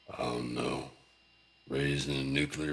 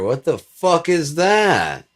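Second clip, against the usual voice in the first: much higher, louder, slow dismay; shocked outburst; 12 semitones higher, 12 dB louder, slower than usual. Real, not acted. Staring at back fat, shouting in horror. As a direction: yell it in disgusted disbelief.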